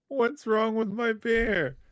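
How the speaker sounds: very high, slow, hurt whine; whiny question. Retold or imitated. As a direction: whine it like a wounded kid. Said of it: "He's like".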